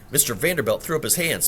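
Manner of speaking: plain reading voice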